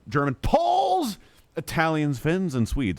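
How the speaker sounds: mock excited shout. Streamer mock-reads game text.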